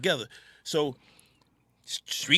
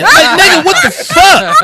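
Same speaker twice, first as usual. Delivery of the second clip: loud, high, laughing disbelief; outraged shout; 14 dB louder, slower than usual. Real, not acted. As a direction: yell it in mock outrage at the friends. Outraged at cold soda can.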